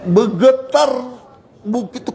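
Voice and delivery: ordinary talk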